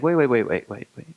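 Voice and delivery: rapid placating stream of words; hurried interruption. Acted. Frog interrupts the angry mouse, hurriedly placating. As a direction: cut in quickly to stop him.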